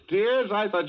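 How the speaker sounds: high, loud "Tears?" in mock surprise; teasing surprise. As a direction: jump up in pitch, gently teasing her. Son teases crying mother.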